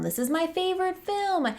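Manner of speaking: mock the eager fans gushing over their favourite. Imitating excited viewers raving about their favourite film, high and gushing.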